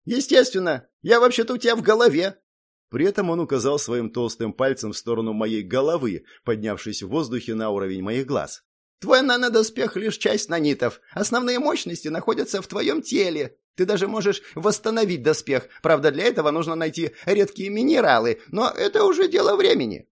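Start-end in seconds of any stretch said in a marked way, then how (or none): 0.1-2.4 high, quick gnome retort; indignant gnome. A narrator's reading. Gnome answering the hero's thought, indignant. 9.0-20.1 high-pitched gnome voice, rising at the end; lecturing gnome. A narrator's reading. Gnome explaining the armour to the hero, lecturing.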